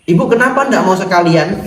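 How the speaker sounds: loud, high imitation of a grumpy shop boss; mock scolding. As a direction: scold the customer, hammed up. Bos galak membentak pelanggan. (meniru bos galak yang membentak)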